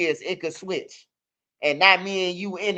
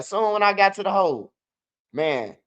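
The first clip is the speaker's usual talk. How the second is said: usual speaking voice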